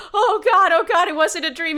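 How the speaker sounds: loud, panicked outburst; freaking out. Acted. Kid realizing the witch was no dream, loud panic. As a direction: blurt it out in acted panic.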